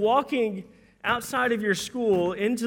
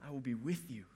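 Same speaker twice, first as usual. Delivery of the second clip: soft, low, gentle reassurance; a quiet, tender God answering; hushed voice of God; 5 semitones lower, 11 dB quieter, faster than usual. Acted. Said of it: "God's answer is".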